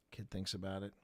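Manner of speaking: ordinary talk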